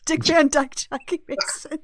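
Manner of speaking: high, loud start breaking into laughter; words dissolving into real laughter; laughing. Real, not acted. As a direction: crack up while saying it. Cracking up at absurd impression idea.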